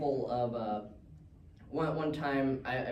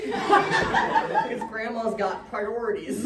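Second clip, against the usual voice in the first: laughing; 5 semitones higher, 5 dB louder, slower than usual. Real, not acted. Repeated punchline, laughing through it.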